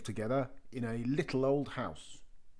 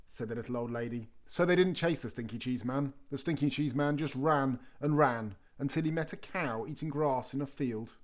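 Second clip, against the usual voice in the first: usual speaking voice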